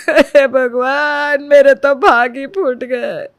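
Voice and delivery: slow, loud wailing; theatrical lament. Acted. Mother-in-law wails slowly to win the neighbour's pity. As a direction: drag out the lament. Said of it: "रो रो कर तमाशा".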